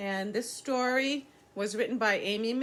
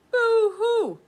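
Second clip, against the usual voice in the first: high, loud boo-hoo wail, much higher and louder; mock crying; 10 dB louder than usual. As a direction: wail it like a sad little beaver. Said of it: "said the voice from across the pond".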